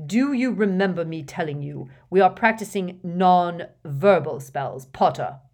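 loud reproach, slowing on Potter; cold scolding. A narrator's reading. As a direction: dress the student down. Angry teacher scolding Harry.

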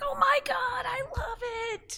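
high, gleeful fan squeal; excited squeal. Retold or imitated. Rider spots someone in an Adventure Time costume, squealing with excitement. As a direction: cry out in delight, pitch way up. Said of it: "there's this excitement that's just like".

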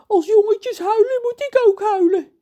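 very high, loud tearful wail; about to cry. Acted. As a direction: whimper on the edge of tears. John met trillende onderlip. (huilerig jammeren)

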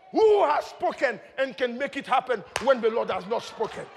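loud, high declamation of scripture; full-force preaching. Real, not acted. Preacher declaiming psalms and names of God, fervent full-force preaching. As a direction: proclaim praise with mounting fervour.

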